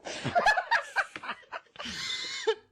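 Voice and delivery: loud laughter. Real, not acted. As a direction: burst of high laughter. Bursts out laughing at clip.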